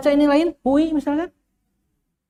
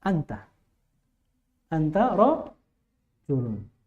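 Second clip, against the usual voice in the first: "Anta rojulun" said slowly, lower and softer; slow correct reading; 5 semitones lower, 7 dB quieter, much slower than usual. Shown as a demonstration. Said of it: "Yang benar adalah an-, anta". (bacaan benar pelan "Anta rojulun")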